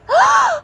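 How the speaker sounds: sharp high gasp. Acted. Littlest rabbit seeing the talking nut, sharp gasp. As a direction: gasp in shock. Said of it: "shrieked Littlest Rabbit".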